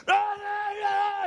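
shouted la la la, mocking her back; mock yelling. Husband shouting her own la la back at her. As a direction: yell the la las right at her. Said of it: "he's yelling at her".

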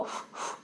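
dos soplos de aire sin voz; soplido imitado. Retold or imitated. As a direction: sopla como dando aliento de vida. Dios soplando aire de vida. (imita el soplo de Dios, dos soplidos sin voz)

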